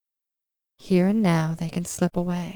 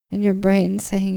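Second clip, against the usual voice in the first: ordinary talk, nothing marked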